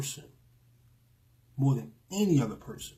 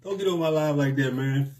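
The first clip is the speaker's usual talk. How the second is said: laughing through a sudden loud "Bro"; choked up with laughter, half shouting; laughing, moved; 8 dB louder than usual. Real, not acted. Teasing a viewer who moved him, with laughter. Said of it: "I'm about to get emotional".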